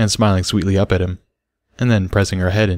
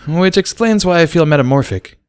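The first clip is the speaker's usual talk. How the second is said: punning filly; 9 semitones higher, 3 dB louder than usual. A narrator's reading. Sparkler joking.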